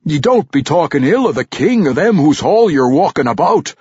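loud, high gruff dwarf rebuke; scolding. Acted. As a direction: scold him like a crusty veteran. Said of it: "Ragged Dane scolded".

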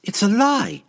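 high-pitched cried denial; indignant woman's high outcry; outraged cry. A narrator's reading. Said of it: "Canterbury cried".

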